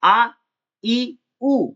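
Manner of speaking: drawn-out vowels आ, ई, उ each sounded alone; vowel demo. Shown as a demonstration. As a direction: sound out आ, ई, उ slowly. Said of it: "जैसे मात्रा".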